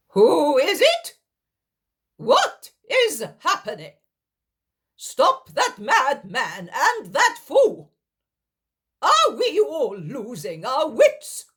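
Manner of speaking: loud, high, slow furious shout; angry shouting. Acted. Helkai shouting at the madman and the fool, loud high angry. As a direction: bellow at the madmen. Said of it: "shouts Helkai".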